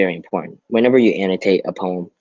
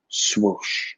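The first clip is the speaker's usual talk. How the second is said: whispered swoosh; 2 semitones higher, 4 dB quieter than usual. Shown as a demonstration. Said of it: "expression is being used".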